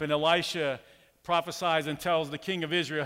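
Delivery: usual speaking voice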